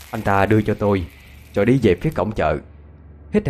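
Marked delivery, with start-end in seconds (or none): none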